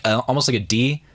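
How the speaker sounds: ordinary delivery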